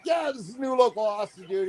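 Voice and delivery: high, excited imitation; enthusiastic hype. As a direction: talk him up with excitement. Retold enthusiastic introduction of him.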